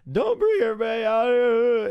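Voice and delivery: high, loud whimpering mock plea; whiny crying mock. Knocked-out guy begging her not to bring the crowd, mock whining. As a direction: whine it like a beaten man.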